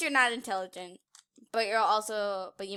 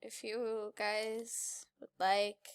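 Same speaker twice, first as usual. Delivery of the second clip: usual speaking voice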